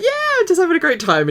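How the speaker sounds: high, loud, fast mock enthusiasm; imitated excitement. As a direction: gush in a squealed impression of a friend. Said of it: "Emma's just like".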